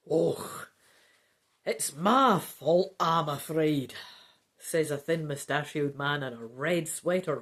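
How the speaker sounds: put-on Scottish accent, unhurried; Scots voice. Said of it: "I'll give him another Scots voice".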